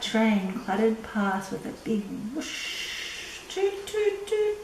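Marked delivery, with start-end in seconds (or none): 2.3-3.4 train whoosh. Acted. Train sound in story. 3.5-4.6 high hooting toots like a train horn. Acted. Storyteller imitating the midnight train, high hooting toots.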